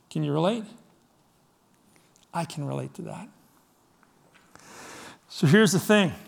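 ordinary delivery

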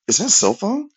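very high-pitched, put-on incredulous question; mock supervisor. Supervisor spotting a worker's cell phone, mock incredulous. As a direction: squeak it out as a mocking imitation.

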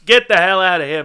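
loud, high-pitched dismissal; acted angry command; angry order. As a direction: order him out at full volume. Orders Manny out of office.

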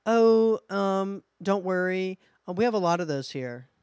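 high, loud, breezy staff voice; park worker shrugging off the snake; casual park worker. Retold or imitated. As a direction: brush it off cheerfully. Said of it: "the Universal guy comes up and says".

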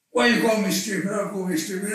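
put-on high, loud childish indignation; mock indignant protest. Answering his wife who called him stupid, hammed-up indignation. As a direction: protest like a hurt kid.

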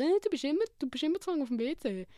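a high, whiny jab that settles back down; mock accusing partner. Petty partner nagging about the toilet, mock accusing. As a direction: snipe at him over a petty habit.

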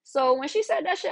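usual speaking voice

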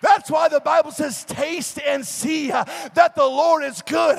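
high, loud, driving sermon shout; full-force preaching. Real, not acted. Preacher urging people to taste God for themselves, full-force preaching. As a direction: preach it at a shout, stretching key words.

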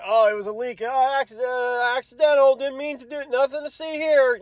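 high, sing-song fake innocence; mock innocent. Administration feigns accident, acted.

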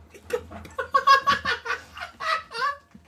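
shrieking cackle of ridicule; shrieking laugh at a friend; high mocking laugh. Real, not acted. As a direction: burst into a high squealing laugh. Mocking friend who bled.

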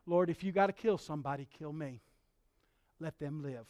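low, very soft plea, fading away; Moses. Retold or imitated. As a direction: plead quietly for others' lives. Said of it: "Moses says".

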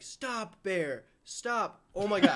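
high-pitched calls of alarm, not truly screamed; half-hearted high-pitched yelling at a bear; mock scream at bear. Shown as a demonstration. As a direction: demonstrate scaring off a bear. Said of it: "supposed to scream at them".

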